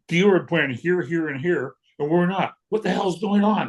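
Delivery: high-pitched indignant complaint, retold; hammed-up outrage on the edge of a laugh; mock cursing rant. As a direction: imitate a friend griping, pitch climbing to the final question. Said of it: "he's cursing".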